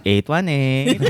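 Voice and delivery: slow, sing-songy Filipino accent; Filipino-accented phone number, drawn out. Shown as a demonstration. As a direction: lilt the digits in a Filipino accent. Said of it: "as a very sing-songy".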